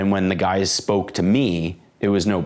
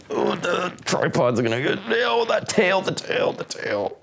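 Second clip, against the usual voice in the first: alarmed; 9 semitones higher than usual. Real, not acted. Dog's tail near tripod.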